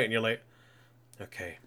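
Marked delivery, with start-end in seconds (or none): 1.2-1.6 much softer, lower and slowing; hushed reaction. Retold or imitated. Viewer reacting to her seeming death, hushed. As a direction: drop to a solemn hush. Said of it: "she's really quiet".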